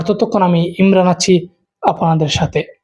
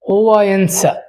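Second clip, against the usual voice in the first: usual speaking voice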